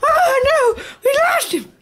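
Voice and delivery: high, laughing cry of alarm; excited shout. Real, not acted. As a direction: yelp it out half laughing. Dog vanishes from view.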